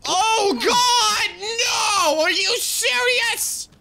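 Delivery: high, slow wailing cry of despair; anguished shout. Real, not acted. Gamer wailing at a big fall, anguished shout. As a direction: scream in disbelief.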